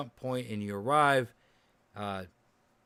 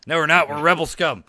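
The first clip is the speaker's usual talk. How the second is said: excited; 5 semitones higher, 13 dB louder than usual. Real, not acted. Joking group name correction.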